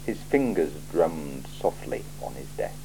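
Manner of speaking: usual speaking voice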